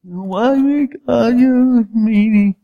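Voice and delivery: hammed-up complaint to God like a sulky kid; mock whine to God. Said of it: "I whine sometimes to God".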